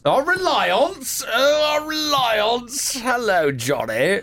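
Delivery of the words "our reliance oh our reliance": high, long-drawn mimicry; mock echo. Co-host parrots the newsreader's phrase, mocking. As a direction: stretch the words out high, teasing. Mock-echoes co-host's wording.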